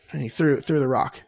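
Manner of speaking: normal talking voice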